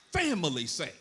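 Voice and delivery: normal talking voice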